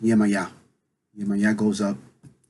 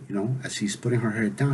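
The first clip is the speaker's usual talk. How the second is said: ordinary delivery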